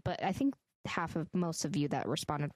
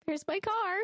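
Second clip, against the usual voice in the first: mock wail; 13 semitones higher than usual. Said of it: "she's like".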